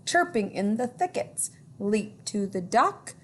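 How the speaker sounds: put-on lilting read-aloud for children; storytime reading. A narrator's reading. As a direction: read it like a picture book to kids. Reading rhyming book aloud.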